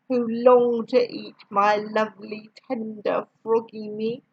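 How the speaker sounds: plain reading voice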